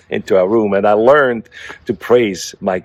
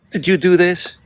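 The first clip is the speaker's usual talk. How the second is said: higher-pitched accusing question to the cat; 10 semitones higher than usual. Retold or imitated. Owner confronting the cat over the pee on the floor, accusingly. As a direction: put the blame on the cat, pitched up. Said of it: "I'm like".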